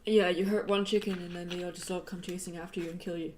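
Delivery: ordinary talk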